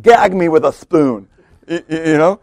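slow, drawn-out valley girl put-on; hammed-up valley girl drawl. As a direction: do a mock-disgusted valley girl. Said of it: "Jesus being the valley girl".

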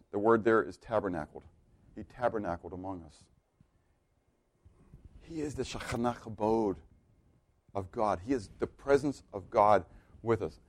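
low, soft, with weighty pauses; hushed reverent preaching. Real, not acted. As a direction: let each phrase sink in quietly, in awe. Reverent scripture exposition, hushed.

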